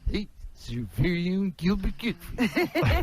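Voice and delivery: fast, lightly altered host voice; a breezy sitcom dad, barely changed; Alan Thicke impression. Comic doing Alan Thicke for radio hosts, a light fast take. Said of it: "Here's my Alan Thicke".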